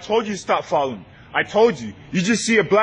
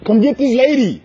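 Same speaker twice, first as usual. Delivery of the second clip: calling for manager; 4 semitones higher, 4 dB louder than usual. Real, not acted.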